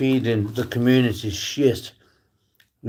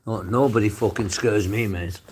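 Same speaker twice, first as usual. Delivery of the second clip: ordinary talk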